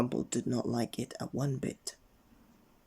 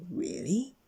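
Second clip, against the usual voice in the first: wizard; 8 semitones higher than usual. Acted. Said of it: "said the wizard".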